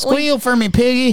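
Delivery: Deliverance villain impression. Quoting Deliverance to his cohost, high mock hillbilly taunt. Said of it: "Deliverance is when the man".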